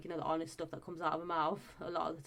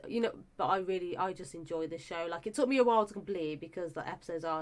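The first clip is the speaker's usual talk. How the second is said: ordinary talk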